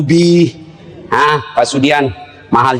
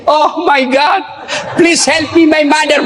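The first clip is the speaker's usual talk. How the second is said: mock English prayer; 4 semitones higher, slower than usual. Preacher mocking a child praying in English, hammed-up. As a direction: ham up a panicked prayer in English.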